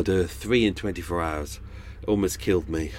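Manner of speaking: ordinary delivery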